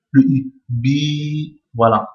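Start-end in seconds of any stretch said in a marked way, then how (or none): 0.7-1.6 "Bi" held long on a steady note; prolonged i. Shown as a demonstration. Teacher repeating the long i in mid-word, "Bi" drawn out long. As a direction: sustain the i in "Bi". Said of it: "on prolonge le".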